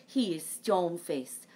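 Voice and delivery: ordinary delivery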